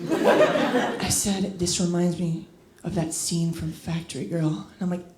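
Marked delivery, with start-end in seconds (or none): 1.6-4.5 low, hushed pillow-talk voice; mock whisper. Boyfriend close to her ear mid-lovemaking, mock whisper. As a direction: murmur it softly, imitating a lover. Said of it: "he whispers".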